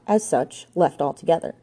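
ordinary delivery

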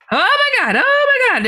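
high-pitched mock panicked cry; retold frantic phone call, voice shooting up; imitated panic. Roommate phoning her friend after a scare, panicked. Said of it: "calls me in a panic".

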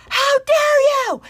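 very high, loud, drawn-out mock outrage; imitated furious scream. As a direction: shriek it slowly like an outraged neighbour. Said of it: "And she'll start screaming".

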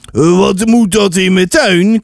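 put-on slow old man's challenge; stern old man. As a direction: confront trespassers like a grumpy old man. Old man confronts boys in garden.

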